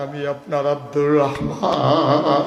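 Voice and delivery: last word long drawn and wavering; emotional plea. Acted. Companion revealing himself to the Prophet, drawn-out emotional chant. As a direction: reveal yourself, stretching the name out.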